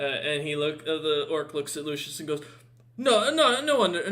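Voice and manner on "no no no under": hasty orc reassurance. Acted.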